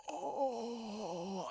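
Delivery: long, drawn-out mock moan; hammed-up lustful sigh; aroused moan. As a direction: imitate a smitten man's "oh". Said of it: "hear Jackie Chan do those voices".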